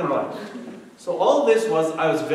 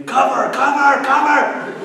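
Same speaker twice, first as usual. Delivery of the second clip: put-on screaming toddler; 11 semitones higher, 11 dB louder, slower than usual. Toddler son screams for dad at night, mock screaming. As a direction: imitate a toddler's high, loud scream. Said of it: "then he starts screaming".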